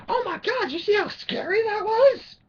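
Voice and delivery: very high, slow, gushing mock voice; mock excited moviegoer. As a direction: ham up the breathless fan, pitched far up. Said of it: "and they're like".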